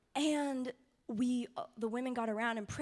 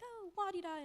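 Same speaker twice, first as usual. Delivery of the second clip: mock breezy dismissal; 9 semitones higher than usual. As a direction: toss it off lightly, imitating glib comfort. Mimics glib dismissal of pain.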